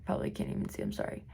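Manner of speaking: soft unvoiced whisper; whispered apology. Real, not acted. Quiet apology aside to camera.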